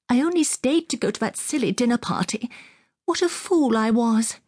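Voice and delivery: high-pitched woman's lament; distressed cry. A narrator's reading. Woman admitting her mistake to the young officer, distressed cry. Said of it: "she cried".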